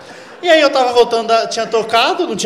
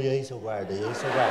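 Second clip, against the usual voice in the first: much lower, softer, acting innocent; casual fake greeting; 9 semitones lower, 9 dB quieter than usual. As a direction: play it cool with the cop. (cumprimento forçado, grave e baixinho)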